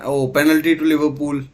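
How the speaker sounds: excited exclamation. Real, not acted. Commentator calling a penalty to Liverpool, excited. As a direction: react live as the penalty is given.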